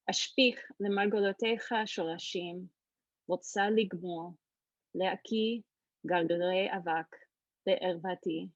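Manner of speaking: fast, flowing reading in Hebrew; Hebrew poetry reading. Shown as a demonstration. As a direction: read the poem aloud in Hebrew. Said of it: "so you can hear the Hebrew".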